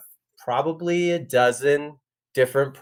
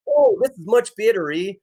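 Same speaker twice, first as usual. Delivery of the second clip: a light, hammed-up Canadian accent, higher; joking Canadian accent, a bit higher; 6 semitones higher than usual. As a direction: play up a mock Canadian, pleased to be home. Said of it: "you come back to Canada and you're like".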